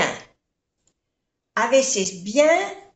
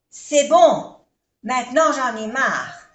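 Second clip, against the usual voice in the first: sharp, much louder fed-up snap on C'est bon; 2 semitones higher, 6 dB louder than usual. Shown as a demonstration. Teacher showing someone who has had enough, loud and fed up. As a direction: bark it out, sick of it all. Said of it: "ahora estoy harto o harta".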